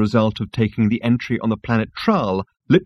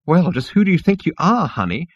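flouncing; 9 semitones higher than usual. Acted. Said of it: "flounced the insect".

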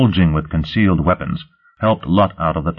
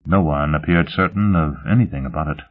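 ordinary delivery, nothing marked

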